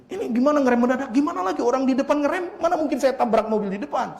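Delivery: high, quick arguing voice; acted-out roadside complaint; retold indignant protest. Acted quarrel over the crash.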